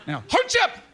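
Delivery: high announcer call of the name. Acted. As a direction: sing out the name like a host. Calling out name with flourish.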